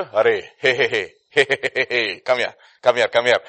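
loud, repeated calling-over; brash summons. Acted. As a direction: call the accuser over with bluster. Acts out summoning the accuser.

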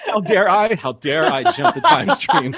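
loud, high-pitched mock indignation through laughter; mock outrage. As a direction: play up being scandalised, laughing. Joking mock outrage at own joke.

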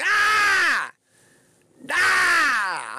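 long, high-pitched acted scream; loud scream. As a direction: let out a drawn-out frustrated yell. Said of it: "you wanna scream out loud".

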